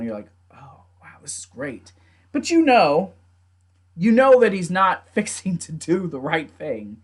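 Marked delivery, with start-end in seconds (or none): 0.5-1.9 very soft, low aside; hushed awe. Retold or imitated. Viewer watching an emotional scene, hushed awe. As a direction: imitate an impressed viewer, almost under the breath. Voices a moved viewer's reaction.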